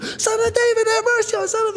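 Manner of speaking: high, loud desperate plea; mock scream. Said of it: "he's screaming on the top".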